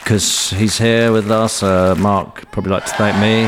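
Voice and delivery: acceptance speech. Pretend.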